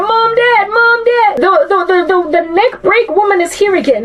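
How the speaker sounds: put-on high, far, panicked little girl voice; mock terrified child crying out for parents; frightened child calling. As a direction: squeal for mom and dad like a scared kid. Child screaming for parents, retold.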